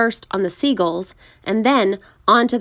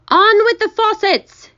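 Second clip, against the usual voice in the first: loud high-pitched shout; high, ringing yell; shouted command; 13 semitones higher, 6 dB louder than usual. A narrator's reading. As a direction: shout it out like a far-reaching order. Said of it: "it shouted".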